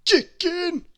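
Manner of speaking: sudden high, loud cry. A narrator's reading. Mister Greedy, smelling dinner in the dark, blurting out loud. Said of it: "he suddenly said out loud".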